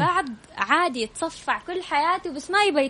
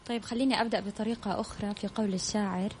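far from mic; 3 semitones lower, 11 dB quieter than usual. Real, not acted. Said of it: "على المايك بعد إذنك".

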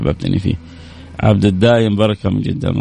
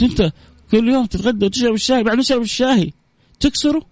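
much higher incredulous question; disbelieving question; 14 semitones higher than usual. Retold or imitated. Anchor questioning the artist, high and incredulous. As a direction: ask it as if you can't believe it.

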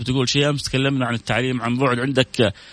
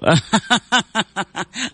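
high laugh; 11 semitones higher than usual. Real, not acted. Laughs at own words.

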